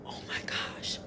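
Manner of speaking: breathless, stunned whisper; hushed mock terror at the lady's eyes; shocked whisper. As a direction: gasp it out under your breath in horror. Friend shocked by the woman's eyes.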